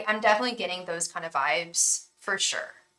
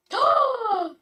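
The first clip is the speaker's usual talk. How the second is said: sharp gasp; 5 dB louder than usual. Real, not acted. Reads card meaning, shocked.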